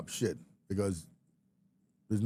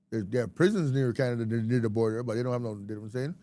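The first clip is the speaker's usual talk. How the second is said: normal talking voice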